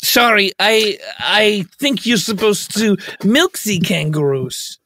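put-on lightly clumsy German tourist accent; slightly halting German-style English. Plays foreign tourist on tour.

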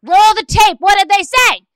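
high, loud, hammed-up demand; housewife-scene outburst. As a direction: yell it like a reality-show confrontation. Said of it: "I'm in a housewife scene with her".

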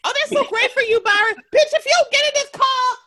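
very high squealing mockery with laughter; laughing high-pitched parody of a friend; mock gushing. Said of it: "mocking her gushing then his demand".

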